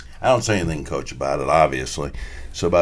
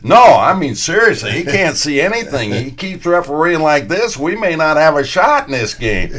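higher, louder mock-earnest complaint; loud baiting; 7 semitones higher, 6 dB louder than usual. Heckler insists to his colleague the referee can't see, loudly baiting. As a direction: protest so the referee is sure to hear.

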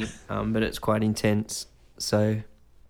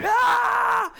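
high mock panicked shriek; imitated group scream; 3 dB louder than usual. Everyone in the room screaming, high mock shriek. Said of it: "everyone's just going".

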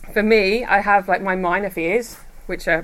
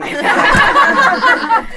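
loud, high burst of laughter; 8 dB louder than usual. Real, not acted. Speaker bursting into high laughter at her own story.

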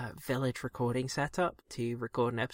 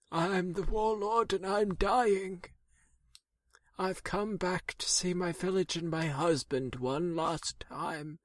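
high, strained dying warlord voice fading out; 4 semitones higher than usual. Acted. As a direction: play a warlord on his last legs. Dying warlord returns home in skit.

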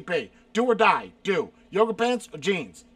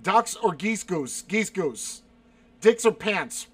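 ordinary talk, nothing marked

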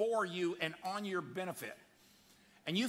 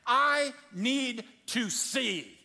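loud, slow, drawn-out plea; earnest plea; 6 semitones higher, 7 dB louder, much slower than usual. Acted. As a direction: put the whole need into each word. Bartimaeus pleading with Jesus.

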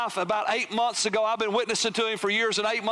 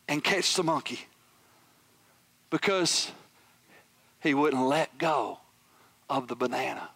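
hushed punchline; 6 semitones lower than usual. Real, not acted. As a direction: land the moral word by word, dropping low. Lands the story's point quietly.

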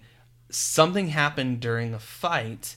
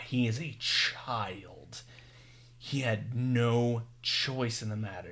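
grave reproach; 7 dB quieter, slower than usual. Retold or imitated. Retelling Superman confronting the wizard, slow and quietly grave. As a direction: press each word softly and deliberately.

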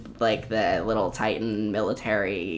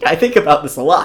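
laughing; 6 semitones higher, 9 dB louder than usual. Real, not acted. Laughing at own obsession.

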